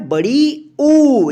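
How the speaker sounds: बड़ी ऊ said very slowly, ऊ stretched far out; long drawn-out ऊ. Shown as a demonstration. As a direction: drag the ऊ out long. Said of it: "जहाँ पे खींचकर उच्चारण होगा".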